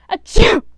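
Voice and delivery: huge high sneeze; loud sneeze. A narrator's reading. Sneezy sneezing over the tray, loud. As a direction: explode into it. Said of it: "Sneezy spilled all the drinks".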